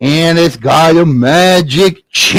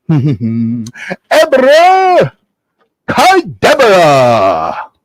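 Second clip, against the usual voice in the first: slow, drawn-out magic spell; wizard's incantation; much slower than usual. Acted. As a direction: stretch out the magic words grandly.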